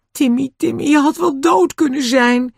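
high, emotional cry of relief and fright; distraught. A narrator's reading. Owner clings to dog that nearly died, high distraught cry. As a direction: voice far up, overcome by what nearly happened.

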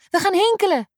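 put-on high, excited girls' voices; eager call. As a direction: sell the fun of hopscotch. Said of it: "roepen ze".

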